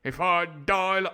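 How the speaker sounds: loud, slow, high melodrama; hammed-up upper-class actor playing a working Londoner; fifties Hollywood cockney. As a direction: renounce a friend like an old-film actor. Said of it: "the voice I'm doing now".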